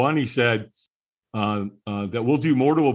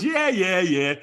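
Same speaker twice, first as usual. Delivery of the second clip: dismissive brush-off; 7 semitones higher, slower than usual. Retold or imitated. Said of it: "He just kinda slushed it off".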